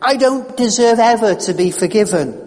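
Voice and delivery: a desperate patient raising his voice; rising voice. Retold or imitated. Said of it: "His voice was rising like my own".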